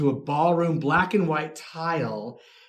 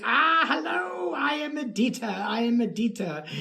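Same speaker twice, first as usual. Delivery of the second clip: retold grand old lady; 8 semitones higher than usual. Old lady introduces herself to a celebrity shopper, theatrically high.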